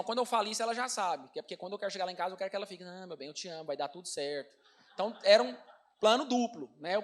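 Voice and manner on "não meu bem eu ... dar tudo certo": a soft, much quieter comforting murmur; tender reassurance. Retold or imitated. Imitating his wife soothing him with soft tenderness. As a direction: soothe him gently, lower and hushed.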